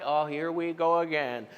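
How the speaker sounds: tired, sinking sigh of a line; a soft, weary mock moan at night; weary groan. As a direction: sound worn out by it already. Mimics waking at night.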